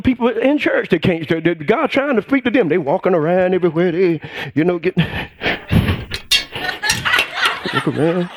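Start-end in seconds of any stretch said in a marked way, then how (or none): 5.0-7.7 high, airy burst of laughter; real laughter mid-sentence; high breathy laugh. Real, not acted. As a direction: break into a light laugh.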